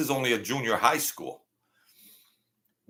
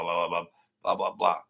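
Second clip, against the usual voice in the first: ordinary delivery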